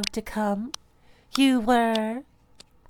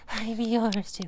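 normal talking voice

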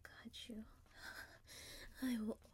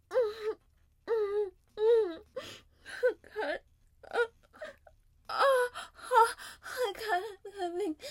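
climax moans; 8 semitones higher, 15 dB louder than usual. Acted. Climax moaning, very loud.

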